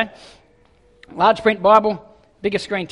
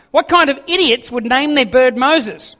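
mocking burglar; 5 semitones higher, 6 dB louder than usual. Burglar mocks parrot.

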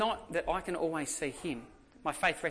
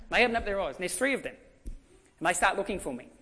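ordinary talk, nothing marked

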